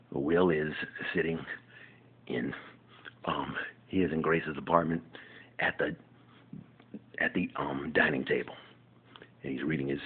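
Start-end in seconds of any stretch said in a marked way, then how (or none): none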